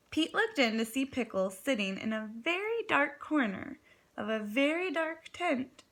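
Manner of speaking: ordinary delivery, nothing marked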